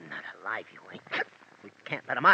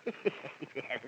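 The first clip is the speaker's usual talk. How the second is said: gleeful chuckle; 2 semitones lower, 9 dB quieter than usual. Acted. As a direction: chuckle quietly, pleased with yourself. Henchman enjoys his own joke.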